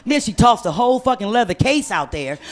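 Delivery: ordinary talk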